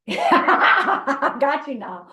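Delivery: gotcha, laughing at him; gleeful laughing gotcha; laughing tease. Real, not acted. Teasing friend after a prank reveal.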